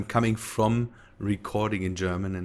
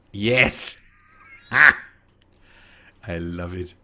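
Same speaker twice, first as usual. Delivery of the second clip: delighted; 2 semitones lower, 7 dB louder, much slower than usual. Real, not acted. New mod works, delighted.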